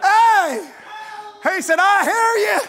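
very high, loud revival shouting; full-force preaching. Real, not acted. Preacher telling sinners to follow the voice, full-force preaching. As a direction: holler it out in exultant, rhythmic bursts.

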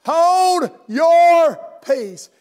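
a loud, drawn-out mock rebuke; mock shout. Preacher voicing the crowd silencing the beggar, hammed-up shout. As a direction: bark the crowd's hush at the beggar, stretched out slow. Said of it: "they said".

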